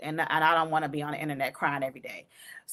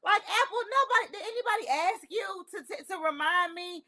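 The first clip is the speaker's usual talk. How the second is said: exasperated; 11 semitones higher than usual. Real, not acted. Exasperated rant at Apple.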